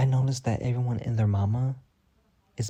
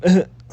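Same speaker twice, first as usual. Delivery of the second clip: loud sudden laugh; burst of laughter; 9 semitones higher, 13 dB louder than usual. Real, not acted. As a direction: crack up loudly at yourself.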